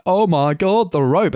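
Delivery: hammed-up damsel in danger; mock panicked cry. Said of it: "Just stands there screaming".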